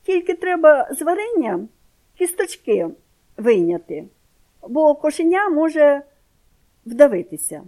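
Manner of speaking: put-on loud, high scornful boy's voice; mock the aunt with disdain; contemptuous. A narrator's reading. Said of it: "кажу я зневажливо". (зневажливо, голосно)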